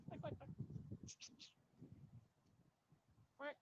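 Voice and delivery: high soft duck quacking; quiet high cartoon duck quacks. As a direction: coo quacks at the ducks.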